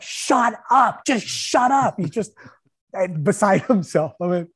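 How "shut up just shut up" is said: high, loud angry shout; yelling shut up. Retold or imitated. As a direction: tell him to shut up, furious. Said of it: "he's just like".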